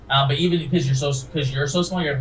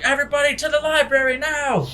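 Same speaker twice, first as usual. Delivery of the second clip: higher barked order; guard shouting; 13 semitones higher than usual. Acted. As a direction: call everyone up urgently. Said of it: "You hear the guard shouting".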